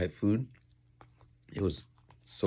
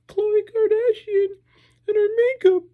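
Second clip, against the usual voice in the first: much higher, louder, slow whiny near-crying voice; Khloe Kardashian impression; 9 dB louder than usual. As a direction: whine it like she's about to cry. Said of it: "That's how she talks. Like she's crying".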